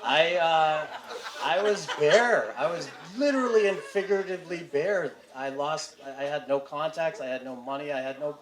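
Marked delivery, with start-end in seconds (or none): 0.0-5.1 laughing. Real, not acted. Emotional confession with laughter.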